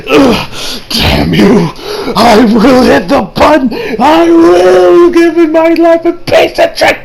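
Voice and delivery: strained, slow, rising yell; hammed-up desperate shouting; desperate villain rage. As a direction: build to a drawn-out furious cry. Acting villain's desperate cry.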